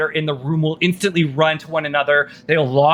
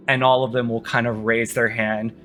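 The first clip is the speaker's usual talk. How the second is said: usual speaking voice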